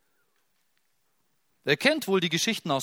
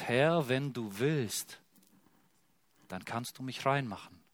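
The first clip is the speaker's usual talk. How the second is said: zaghafte Bitte; 3 semitones lower, 5 dB quieter than usual. Acted. Verzagter Aussätziger bittet demütig.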